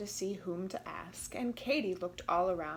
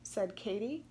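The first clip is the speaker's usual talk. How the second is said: usual speaking voice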